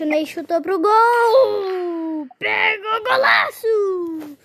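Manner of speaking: goal shouted at full blast; explosive goal commentary. Pretend. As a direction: scream the goal, slow and stretched. Goal scored in game commentary.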